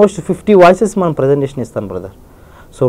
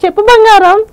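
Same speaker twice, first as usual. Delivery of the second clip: Jeevitha voice. Retold or imitated. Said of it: "Rajasekhar గారి యొక్క voice".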